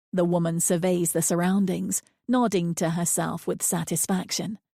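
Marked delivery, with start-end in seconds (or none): none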